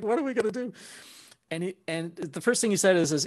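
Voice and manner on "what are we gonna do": high, fretful retold question; hammed-up worry in a high pitch; mock panic. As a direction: act out helpless alarm. Retelling his panic on the drive.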